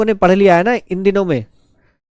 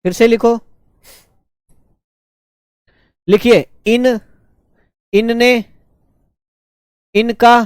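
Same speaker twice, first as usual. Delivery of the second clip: slow, spaced dictation with a pause after each word; 3 semitones higher, slower than usual. Acted. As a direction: call out each word clearly so the students can write it. Dictates word forms to students.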